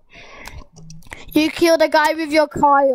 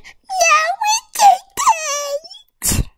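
mock squeal in a very high voice; imitated friend; much slower than usual. Gamer mimics a friend's denial for viewers, high mock squeal. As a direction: squeak it out in exaggerated imitation, broken by a pause. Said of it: "My friend at house was literally saying".

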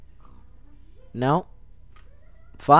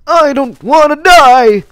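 high, loud, terrified little pig wail; panicked pig cry; 7 semitones higher, 5 dB louder than usual. Pretend. Pig grandpa begging the player for his life, high panicked cry. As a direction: squeal it like a pig about to be slaughtered.

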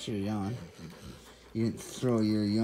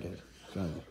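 ordinary talk

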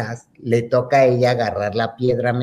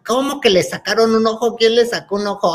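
high-pitched, alarmed mother demanding answers; retold maternal panic, pitched up; frantic mother; 7 semitones higher than usual. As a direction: imitate a mum jumping up in alarm. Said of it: "mi mamá que se para como loca".

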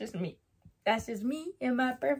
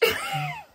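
high-pitched squeal of laughter; squeaky laugh. Real, not acted. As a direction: burst into a short, very high laugh. Laughing at her gripe.

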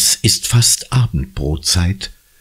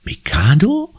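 put-on a high, rising girl's question; surprised; 11 semitones higher than usual. Anna repeats the guess, surprised. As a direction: catch on to the idea.

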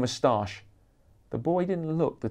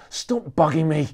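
louder, higher frustrated outburst; cried out; 5 semitones higher, 5 dB louder than usual. Acted. Rock star lashing out at a photographer, loud cry. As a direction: snap back at the pestering man. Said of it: "he cried".

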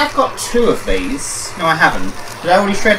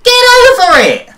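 shout; 9 dB louder than usual. Pretend. Yelling at game character.

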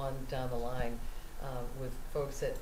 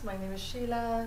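light Indian accent, pitched up; 7 semitones higher than usual. Shown as a demonstration. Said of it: "put on the Indian accent".

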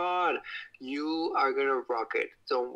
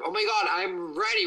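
eager ready; 6 semitones higher than usual. Real, not acted. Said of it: "I always say I'm ready when I'm not".

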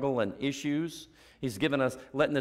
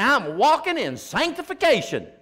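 high, loud, self-satisfied voice; pious boaster; 10 semitones higher, 6 dB louder than usual. Retold or imitated. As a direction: play a proud churchgoer. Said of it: "People say".

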